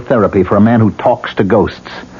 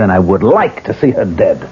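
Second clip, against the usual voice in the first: ordinary talk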